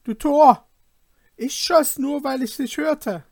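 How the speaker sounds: high, loud scornful yell; angry shout. Acted. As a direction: shout him down with contempt. Brothers quarrel, shouted insult.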